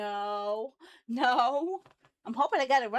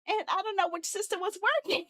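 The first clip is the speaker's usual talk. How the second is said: high whine breaking into stuttering laugh; hammed-up helpless breakdown; despairing whine; 5 semitones higher than usual. Real, not acted. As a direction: whine it, then dissolve on the last word. Despairing over broken code.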